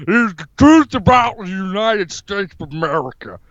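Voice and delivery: hammed-up tough-guy politician impression; comic strongman bluster; Jesse Ventura. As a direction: declare it like a blustering off-grid candidate. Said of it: "Jesse, is your neighbor coming in your house".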